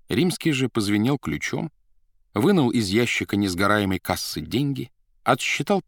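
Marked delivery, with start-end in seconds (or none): none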